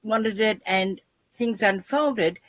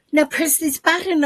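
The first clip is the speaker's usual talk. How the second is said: app instruction; 9 semitones higher, 4 dB louder than usual. Retold or imitated. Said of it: "they go".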